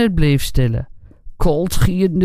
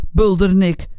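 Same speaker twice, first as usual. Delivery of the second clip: plain reading voice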